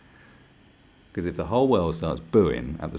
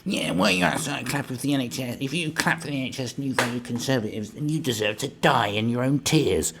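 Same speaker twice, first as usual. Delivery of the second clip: higher put-on hater voice; mock an indignant online scold; caricatured self-righteous commenter; whiny social media hater; 5 semitones higher than usual. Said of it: "you see them on social media going".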